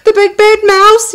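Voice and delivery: high, loud frightened cry; Gruffalo's child shrieks on seeing the shadow; terrified shout. Acted. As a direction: scream out the name in panic. Gruffalo's child screams in terror.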